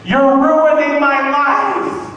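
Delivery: put-on high, loud, slow whining teen girl; bratty teen whine. Comic sketches daughter yelling at her proud mother, high whine. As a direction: wail it like a spoiled sixteen-year-old.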